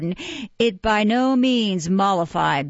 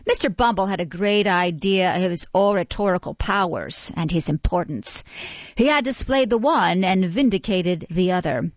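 usual speaking voice

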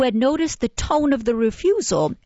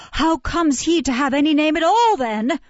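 astonished woman; 8 semitones higher, 3 dB louder than usual. A narrator's reading. Mrs Mann asks in wonder.

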